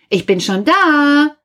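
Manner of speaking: high, singing-out call. A narrator's reading. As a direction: call it as if across a field. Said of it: "rufe ich".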